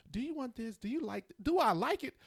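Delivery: high mock questioning of the wife; mimicking wife. Husband mimics his wife asking what he eats. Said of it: "she keeps asking me".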